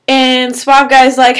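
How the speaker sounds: ordinary talk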